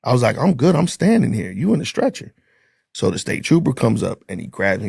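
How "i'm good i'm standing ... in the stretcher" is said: high, disbelieving retort; like she's the one hurt; incredulous. Retold or imitated. Retold reply to injured girl.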